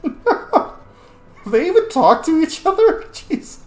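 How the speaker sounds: high squeaky laughing question, slowed; laughing disbelief. Real, not acted. Player laughing at the arranged royal marriage, high and slow.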